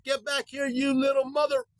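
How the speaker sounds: high-pitched scolding yell, imitating the old lady; mock Filipino lady cursing. Imitating an old lady cursing at dogs who stole her bag, high-pitched mock yell. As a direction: squeal it after the thieving dogs like a furious old lady. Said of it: "it was something to the effect of".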